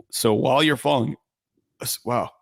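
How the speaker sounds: hiccuping mid-sentence; speech broken by a hiccup. Real, not acted. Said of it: "I got the hiccups".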